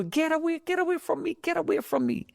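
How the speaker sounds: high, frantic cries of get away; panicked yelling. Retold or imitated. Fleeing crowd on the bridge, pushing people aside, high panicked cries. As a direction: shove through the crowd crying out high and urgent.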